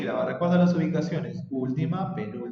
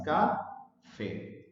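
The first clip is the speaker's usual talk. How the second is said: "café" slowly split as ca-fé; "café" with a pause between ca and fé; broken into syllables; 4 dB quieter than usual. Shown as a demonstration. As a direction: say "café" as two separate, even syllables. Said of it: "Café tiene dos sílabas".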